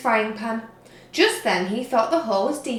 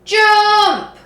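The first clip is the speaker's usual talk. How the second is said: high, loud yell; 11 semitones higher, 11 dB louder than usual. A narrator's reading. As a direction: bark the order. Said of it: "yelled the pirates".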